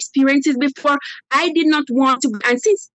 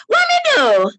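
mimicking husband; 13 semitones higher than usual. Woman mimics husband's innocent reply when confronted. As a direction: play the husband feigning innocence.

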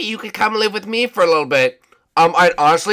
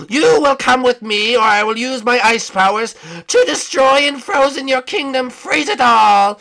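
raised, higher commanding threat; villainous threat; 6 semitones higher, 5 dB louder than usual. Acted. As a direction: threaten them like a cartoon tyrant. Ice King threatens to use force.

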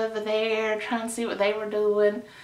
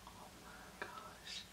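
breathless whispered oh my gosh; stunned hush; awed whisper; 28 dB quieter than usual. Real, not acted. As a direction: whisper it in awe. Sees Gavin walking over.

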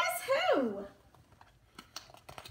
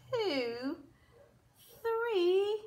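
usual speaking voice

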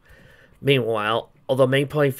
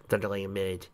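ordinary delivery, nothing marked

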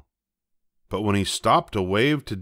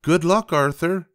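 lightly voiced storybook dad, higher; higher-pitched well-wishing father; father wishing luck; 8 semitones higher, 3 dB louder than usual. A narrator's reading. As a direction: send the child off with an upbeat lift. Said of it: "said Father".

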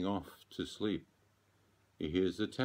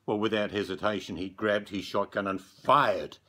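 urgent; 3 semitones higher, 7 dB louder than usual. A narrator's reading. Man fires the shotgun.

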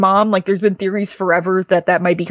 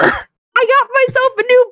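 much higher, cartoonish boy voice; Emil's cheerful voice. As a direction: pitch way up like a cheerful little creature. Emil returns in new body.

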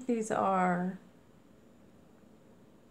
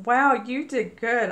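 louder burst of praise; excited praise; 4 semitones higher, 9 dB louder than usual. Real, not acted. Thanking a friend for her happy mail, loud excited praise. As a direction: cheer the sender on.

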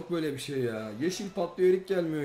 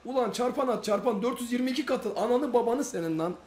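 frustrated yelling; 7 semitones higher than usual. Real, not acted. Streamer yelling at the slot game for multipliers, angry and high. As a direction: yell at the machine, fed up.

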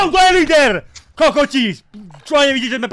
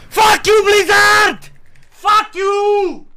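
furious yelling, much higher and louder; angry shout; 9 semitones higher, 4 dB louder, much slower than usual. Real, not acted. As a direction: scream in real rage. Raging at game crash.

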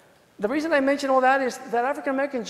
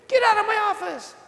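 higher, mock-furious judge throwing him out; angry judge; 11 semitones higher than usual. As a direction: imitate the judge ordering him out. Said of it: "Judge says".

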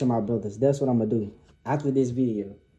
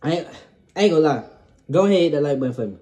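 rushed; 5 dB louder, faster than usual. Real, not acted. Rushed plea while mouth burns.

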